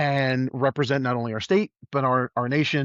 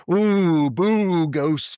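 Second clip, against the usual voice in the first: hammed-up, louder ghost-story wail; mock spooky; 4 semitones higher, 4 dB louder, much slower than usual. Teller mocks hokey tourist ghost tours with a spooky wail. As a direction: play a cheesy haunted-house ghost.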